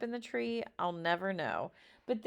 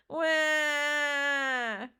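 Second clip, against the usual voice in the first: hammed-up crying wail; a scared boy bursting into mock tears; 7 semitones higher, 5 dB louder than usual. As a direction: let out a cartoonish wah of a sob. Cried my eyes out.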